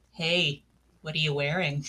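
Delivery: low, soft mock-seductive voice; hammed-up sleazy caller, low and hushed; creepy caller. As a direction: murmur it like a dirty phone call. Acted creepy caller on Arathim phone.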